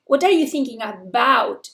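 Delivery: "about" punched on its second syllable, slowly; slow question that lands hard on "about"; stress on final preposition. Shown as a demonstration. Teacher showing question-final prepositions, stress on "about". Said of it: "hay que darle más fuerza".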